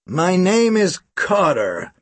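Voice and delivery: put-on slow, drawn-out American accent; a hint of a Chicago drawl, slow. Carter introduces himself in a lightly American accent. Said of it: "He spoke with an American accent".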